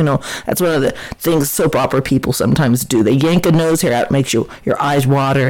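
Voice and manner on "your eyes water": ordinary delivery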